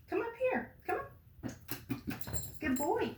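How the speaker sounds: high, sing-song coaxing to a pet; calling a pet. Real, not acted.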